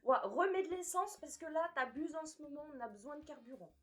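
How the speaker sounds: high, loud voice of an alarmed body; protesting body. Acted. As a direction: play your own body complaining, half laughing. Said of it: "genre mon corps, il me disait".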